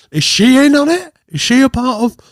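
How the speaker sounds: high, loud disbelieving questions; mock incredulous. Reacting to Emily handing over the note, mock incredulous. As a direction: pitch the suspicion up in mock shock.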